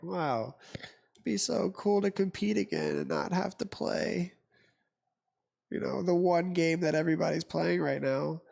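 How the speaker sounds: high-pitched mock wistfulness; envious gamer kids. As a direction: imitate left-out players wishing aloud. Voicing envious Call of Duty players.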